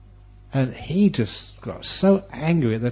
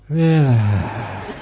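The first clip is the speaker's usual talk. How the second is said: soothing relax. Pretend. Repeating soothing sign word.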